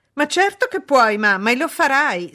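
high, loud, imperious insistence; haughty. A narrator's reading. Daughter overruling her mother, haughtily. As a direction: declare it haughtily, allowing no refusal. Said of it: "scandì altera Blanche".

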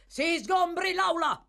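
high, loud courtroom order; commanding shout. Acted. Magistrate ends the case, shouting the order. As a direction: call it out to clear the room.

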